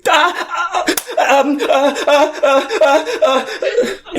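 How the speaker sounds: high, nervous laughing stammer; panicked stammer. Acted. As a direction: babble like a coward caught out.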